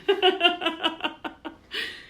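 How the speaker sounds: high, teary laugh. Real, not acted. Laughing after almost crying at the hymn. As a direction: laugh off the tears.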